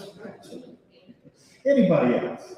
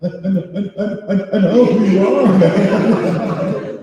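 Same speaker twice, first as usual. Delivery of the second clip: stammering, awestruck and much louder; starstruck stammer; 9 semitones higher, 13 dB louder, much slower than usual. Retold or imitated. Meeting Luke Skywalker, he stammers in awe. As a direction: drag it out, tongue-tied. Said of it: "And I'm like".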